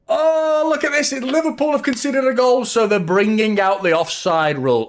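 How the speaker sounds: mock the rivals with exaggerated shock; gleeful scoff at Liverpool fans; mock outrage. Real, not acted. Mocking Liverpool bringing out rule.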